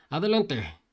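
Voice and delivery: high, loud alarmed shout; shouting warning. Acted. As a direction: yell the warning ahead. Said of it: "el señor le gritó".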